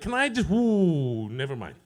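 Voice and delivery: hesitant mock request that deflates; second thoughts. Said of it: "you'd be like".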